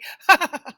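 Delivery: loud, high burst of laughter; big laugh. Real, not acted. Laughs after coffee joke.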